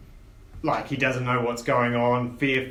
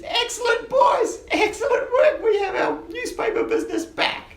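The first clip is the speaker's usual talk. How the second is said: very high-pitched, squeaky delighted boss; Quince. Acted. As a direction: falsetto cheer congratulating the crew. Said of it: "And Quince walks in and says".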